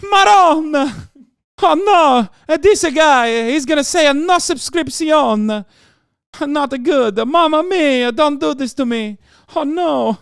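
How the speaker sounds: fake Italian accent. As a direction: play an over-the-top Italian caricature. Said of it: "the fake Italian accent".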